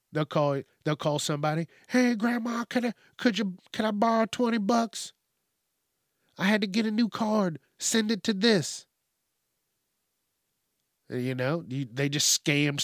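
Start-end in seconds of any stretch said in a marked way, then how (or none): 1.9-8.9 higher, lightly put-on pleading grandkid voice; a hint of a needy grandchild asking for money; scammer grandchild. Scammer calling a grandmother, mildly pleading.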